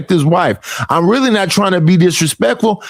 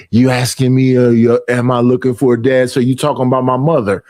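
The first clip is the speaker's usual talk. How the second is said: mocking misreading; 8 semitones lower than usual. Mocking how critic misheard.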